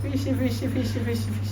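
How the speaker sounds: soft sing-song prompting; coaxing sing-song. Real, not acted. Coaxing toddler again.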